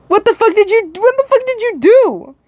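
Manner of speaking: high, panicked voice, no longer demonic. Acted. The unmasked attacker hissing at the dreamer in a high, shocked voice. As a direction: squeak it out in a shocked high hiss. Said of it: "he hisses, his voice no longer the deep demonic sound, but a higher pitch".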